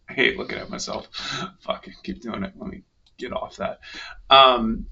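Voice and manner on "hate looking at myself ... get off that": under his breath; muttered aside to himself; muttering. Real, not acted.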